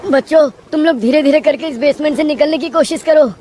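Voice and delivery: high-pitched anxious instruction; urgent teacher. Acted. Teacher guides children out of flooded basement, urgent.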